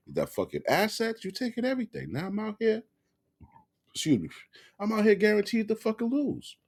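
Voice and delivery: high-pitched aggrieved husband's complaint; indignant rant. Acted. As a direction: protest the unfairness, pitch climbing high.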